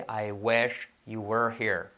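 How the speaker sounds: very slow, drawn-out English example; slow model sentence. Shown as a demonstration. Teacher models English example for learners, slowly. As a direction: spell out each word unhurriedly.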